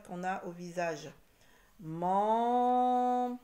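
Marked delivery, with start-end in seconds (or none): 1.8-3.4 "Menton" with first syllable held high, loud and very long; loud, sustained, split "Menton". Shown as a demonstration. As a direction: stretch "Men", pause, then "ton". Teacher sounding out syllables.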